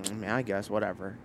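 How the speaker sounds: low, soft, unimpressed mumble; mock indifferent concession; imitated grudging shrug. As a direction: mimic someone reluctantly giving in. Imitates her grudging, unimpressed reply.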